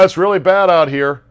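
fake-bracing storm cry; hammed-up reporter. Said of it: "pretending to brace".

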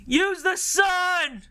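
high, slow shouted heckle; drawn-out mock stadium shout; drunk heckler yell. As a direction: yell it from the bleachers like a drunk fan. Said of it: "He was like".

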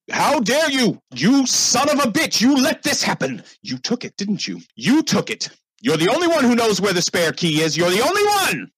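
high, frothing furious shout; screaming accusation. Acted. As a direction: scream in the butler's face. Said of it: "is screaming in his face".

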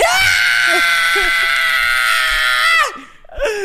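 loud incredulous burst of laughter. Real, not acted. Disbelieving laugh at his rating.

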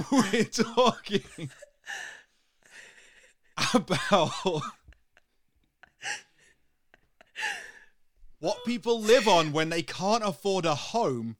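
laughing indignation. Real, not acted. Defending canal boat holidays against teasing, indignant and laughing.

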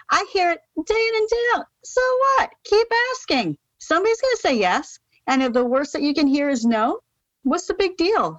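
fired-up defiance. Real, not acted. Passionate defiant advice on rejection.

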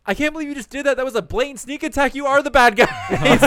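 high-pitched shocked accusation breaking into laughter; indignant child voice. Retold or imitated. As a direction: Nell scolding Ichigo for a sneak attack. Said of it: "and Nell goes".

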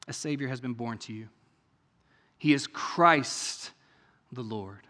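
hushed reading. Shown as a demonstration. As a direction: let the angel's words fall quietly. Reads angel's words, then repeats.

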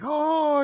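high, held heavenly "oh"; mock revelation tone; imitated angelic chime. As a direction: sustain one high note like a light switching on. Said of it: "It's like".

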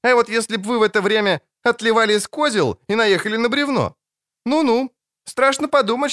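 high, bratty teasing; cheeky girl. Acted. Ciri teases the dwarf about nearly hitting the log. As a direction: needle him playfully, up high.

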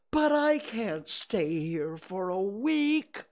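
high, slow wail of protest; drawn-out, high-pitched dismay; dismayed bear. A narrator's reading. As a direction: protest as a distressed little bear. Pooh protests being stuck a week.

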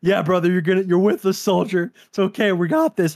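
rally a fallen soldier; reassuring medic. Retold or imitated. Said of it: "I'm like".

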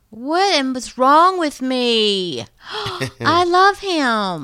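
loud, slow delighted gasp; thrilled outburst of recognition; excited squeal. Real, not acted. Excitedly remembering the Abominable Snowman.

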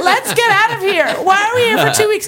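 high, loud mock pleading; hammed-up distraught complaint; retold whiny panic. Begging mom to leave the village, mock panic.

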